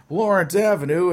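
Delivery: high, playful exclamation of recognition; calling out the culprit. Acted. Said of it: "Carrie and Josh are saying".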